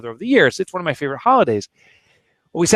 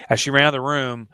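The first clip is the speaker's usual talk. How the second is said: ordinary talk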